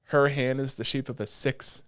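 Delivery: usual speaking voice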